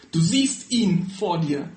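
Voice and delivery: slow, weighty words of Jesus; a solemn slow reveal. Acted. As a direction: let each word of Du siehst ihn vor dir land slowly. Jesus reveals himself, reading the passage.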